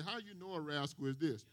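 low, soft, a lightly voiced old babysitter; babysitter's way of saying it. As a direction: recall her gently, quiet and low. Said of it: "This is how she said".

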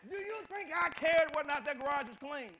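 loud, high rhetorical shout. Real, not acted. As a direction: hurl the question at the crowd. Preacher shouts rhetorical question.

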